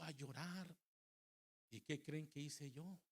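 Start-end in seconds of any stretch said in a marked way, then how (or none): none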